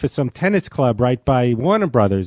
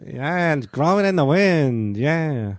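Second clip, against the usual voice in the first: mock folk-singer drawl; slower than usual. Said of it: "It almost sounds like [name]".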